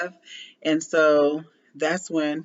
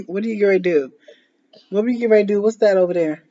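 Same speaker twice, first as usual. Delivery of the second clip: mother coaxing her daughter, rising and louder; playful mom voice; 4 semitones higher, 6 dB louder, much faster than usual. Real, not acted. Asking toddler repeatedly, playful.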